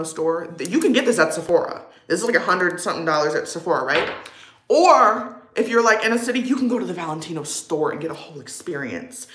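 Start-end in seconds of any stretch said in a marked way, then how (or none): none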